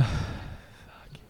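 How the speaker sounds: breathy whispered swear under the breath; whispered curse. Real, not acted. Muttered curse after rejection.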